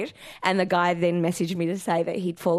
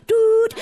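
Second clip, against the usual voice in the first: imitated machine beep; high, loud beep sound; mock voicemail tone; 7 dB louder than usual.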